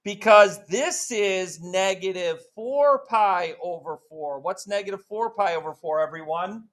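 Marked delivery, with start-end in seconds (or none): 0.0-4.4 drawn-out, slow teaching phrase; slow explanation. Shown as a demonstration. Teacher walking the class through the fraction, slow. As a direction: spell it out slowly. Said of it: "teacher explains slowly".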